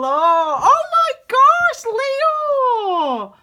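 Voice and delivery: high, loud, slow drawn-out squeal; gasping delight at a great reading; real excited squeal. Real, not acted. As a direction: stretch the joy out, very high. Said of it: "I got a bit excited then".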